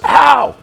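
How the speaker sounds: imitated high "Ow!" yell at full volume; loud pained yell. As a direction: yelp as loud as you can, mimicking pain. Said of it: "he just yells".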